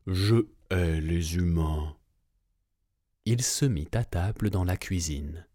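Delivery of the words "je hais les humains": lower, slow growl; growling giant. A narrator's reading. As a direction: grumble it deep in the chest. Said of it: "grogna le géant".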